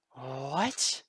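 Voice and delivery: high, loud startled question; gasp of shock. A narrator's reading. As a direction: gasp out the word. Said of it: "Twilight gasped".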